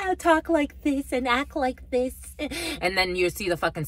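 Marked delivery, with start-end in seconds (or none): 0.1-2.1 higher, put-on sugary voice of a two-faced friend; mocking a fake friend's sweet talk; mock fake sweetness. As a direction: imitate a snake pretending to be nice. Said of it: "a lot of snakes that".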